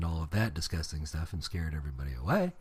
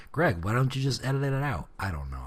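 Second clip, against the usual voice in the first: ordinary talk, nothing marked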